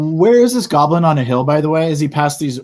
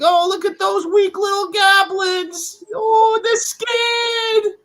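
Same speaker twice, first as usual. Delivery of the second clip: very high, slow, sing-song mockery; mocking falsetto taunt; much slower than usual. Bard mocking the goblins from a distance in a high, slow falsetto. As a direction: sneer at the weak little goblins in a squeaky falsetto. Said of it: "an incredible voice throw".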